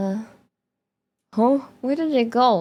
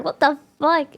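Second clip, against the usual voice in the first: normal talking voice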